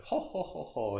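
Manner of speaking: soft Santa Claus ho ho; a quiet hint of Santa's laugh. Shown as a demonstration. Teacher shows the Santa laugh gesture, soft. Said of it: "It's like the Santa Claus thing".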